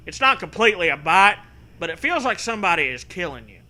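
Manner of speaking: normal talking voice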